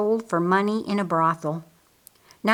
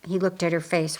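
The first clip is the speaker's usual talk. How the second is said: usual speaking voice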